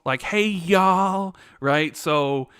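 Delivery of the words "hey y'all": quick mock Southern accent, raised pitch; louder, higher put-on Southern twang; Southern drawl. Shown as a demonstration. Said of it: "have the Southern drawl".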